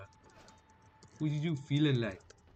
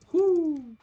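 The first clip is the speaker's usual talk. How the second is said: high loud cheer; victory whoop; 7 dB louder than usual. Real, not acted. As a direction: whoop after the win.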